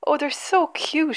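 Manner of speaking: high, drawn-out gushing; mild shriek. A narrator's reading. Daisy thrilled by the new lambs, a mild slow shriek. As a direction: coo slowly and high with delight. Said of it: "shrieked Daisy".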